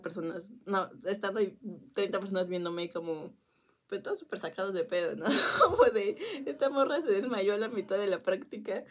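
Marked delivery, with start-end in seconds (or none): 5.3-8.8 laughing. Real, not acted. Classmates staring, she laughs.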